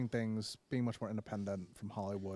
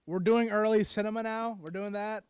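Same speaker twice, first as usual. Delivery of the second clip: surprised; 10 semitones higher, 8 dB louder than usual. Real, not acted. Co-host abruptly switches topic.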